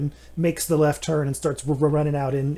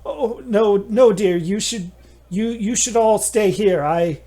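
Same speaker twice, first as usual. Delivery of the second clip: higher, lightly voiced motherly tone; Nanny Cowslip; 5 semitones higher, 4 dB louder, slower than usual. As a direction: reassure the group like a fussing nanny. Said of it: "and she says".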